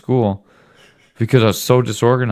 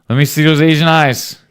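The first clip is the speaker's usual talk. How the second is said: higher and louder, playful teasing; louder teasing repeat; 7 semitones higher, 5 dB louder than usual. Real, not acted. Dad teasing son for a photo, louder playful coax. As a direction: push the line again, louder and higher.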